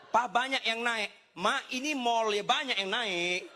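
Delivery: high, drawn-out mock protest; acted argument. Couple argue in the mall, hammed-up protest. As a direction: stretch it out, imitating a bickering couple.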